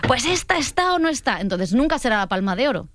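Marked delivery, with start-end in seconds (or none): 0.1-1.4 opposing critic, mock voice. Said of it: "hay gente que dice".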